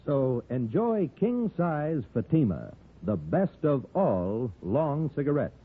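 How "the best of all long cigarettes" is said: ordinary talk, nothing marked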